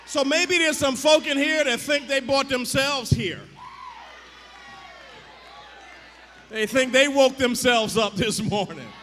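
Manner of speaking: building from lively preaching to a loud, high, shouting sermon; preaching at full force. Real, not acted. Preacher shouting at the congregation about God's grace, full-force preaching. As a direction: whoop it up, pushing every line to the congregation.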